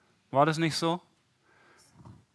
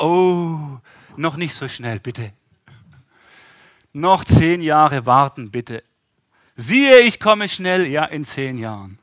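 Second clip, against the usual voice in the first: mock pleading; 9 dB louder, slower than usual. Pleading to delay Lord.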